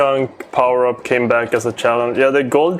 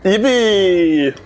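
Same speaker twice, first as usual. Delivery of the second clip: excited cheer; 8 semitones higher, much slower than usual. Real, not acted. Cheering on screen.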